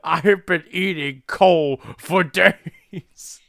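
dim brute. Retold or imitated. Prisoner whining about eating coal, slow dopey moan. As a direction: groan it out like a starving oaf.

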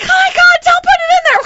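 high, loud, exasperated plea; frantic yelling. Retold or imitated. Paddler begging Grandma to lift the oar, frantic. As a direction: shout it in helpless panic.

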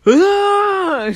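high, loud shriek of fright; retelling a scream at the game, high and loud; startled scream. Retold or imitated. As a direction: shriek as if Chica just appeared. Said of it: "I was just like".

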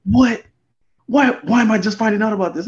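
startled and high, disbelieving; surprised. Retold or imitated. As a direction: blurt it out in shock. Boy's shocked reaction, retold.

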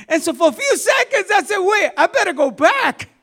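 high, loud, laughing through the panic. Retold or imitated. Preacher laughing at his own panic in the water, high and loud. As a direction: laugh while retelling the scare.

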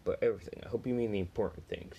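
usual speaking voice

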